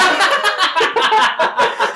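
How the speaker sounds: high burst of laughter; loud laugh. Real, not acted. Laughing at crack pairing.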